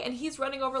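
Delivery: ordinary talk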